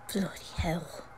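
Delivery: a whispered curse under the breath; dismayed whisper. Acted. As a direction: curse quietly so Malfoy won't hear. Muttered dismay at Malfoy.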